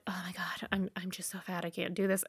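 hushed, mostly whispered self-doubt; whispered inner thought. Retold or imitated. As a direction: breathe it out like a private thought. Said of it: "And I thought".

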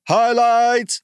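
high, loud winner call; excited announcement. Real, not acted. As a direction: shout the winner's name proudly.

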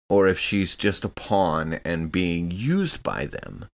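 ordinary talk

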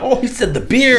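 high, hyped-up fake awe; mock amazed fan. Mimicking the crowd wowed by an obvious line, mock awe. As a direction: gush like a fan stunned by nothing.

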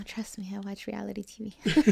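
confiding near-whisper; hushed confession. Real, not acted. Admitting to friend she watches reality TV, hushed confession. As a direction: softly own up, almost whispered.

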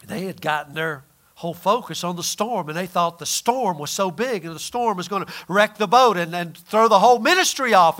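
normal talking voice

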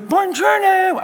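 put-on very high, slow, drawn-out Italian accent on "Buongiorno"; mock Italian accent. Husband mocking wife greeting diners at night, high Italian accent. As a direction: ham up the wife's Italian greeting. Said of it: "She'd go".

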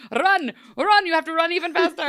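put-on high, loud, fast panicked French accent; Lumiere's French accent. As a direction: urge her on in frantic mock French. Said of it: "Lumiere is still lit".

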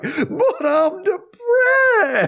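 high, drawn-out fake crying; awful mock wail. Imitating him told he can't have his card, wailing miserably. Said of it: "he just does this awful, like".

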